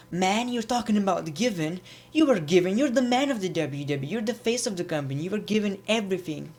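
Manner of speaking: imitating a whiny wrestler; whiny Jason mock. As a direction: whine it back, lightly mocking. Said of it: "Jason Jordan was so annoying".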